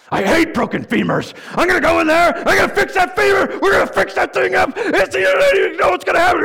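a psychopath surgeon hyped up, yelling; manic surgeon. Retold or imitated. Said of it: "And the surgeon is like".

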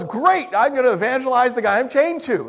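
high, loud, delighted resolve; gung-ho evangelist, played up; acted eager enthusiasm. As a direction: jump on it as a great opportunity. Acts Paul's eager response.

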